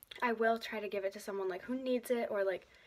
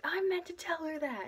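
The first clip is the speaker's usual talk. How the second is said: sudden realization; 9 semitones higher, 3 dB louder than usual. Retold or imitated. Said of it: "I'm like".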